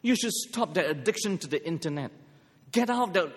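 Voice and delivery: ordinary talk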